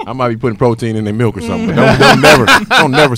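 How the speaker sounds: shouted through laughter, cocky; loud laughing boast. Real, not acted. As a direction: crow it out, laughing hard. Boasting about being [name].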